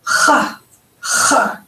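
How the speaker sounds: CHA scraped at the back of the throat; throaty, rasped "kh" on cha; guttural ch. Shown as a demonstration. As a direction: say cha as if coughing up phlegm. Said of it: "It's a guttural sound".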